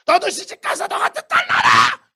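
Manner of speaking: loud, high mock-shouted scolding; mother's scolding curse. Mother cursing her rude daughter, loud scolding yell. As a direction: yell it like an exasperated mom. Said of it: "이러잖아".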